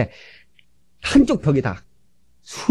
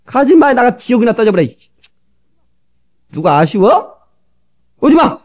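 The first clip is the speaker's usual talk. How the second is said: mock angry retort; 7 semitones higher than usual. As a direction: snap back like an offended husband. Husbands' quarrel acted out.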